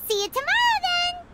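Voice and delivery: high, eager and chirpy; high excited goodbye. Acted. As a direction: sound thrilled to meet tomorrow. Paimon eager for the feast.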